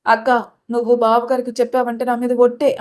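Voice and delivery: ordinary delivery, nothing marked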